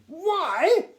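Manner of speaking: high, loud, self-important Duke lecturing; a pompous gnome lord talking down to the boy. Acted. Duke's pompous speech.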